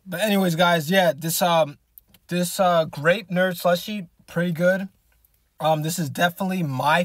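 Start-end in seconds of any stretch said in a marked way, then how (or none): none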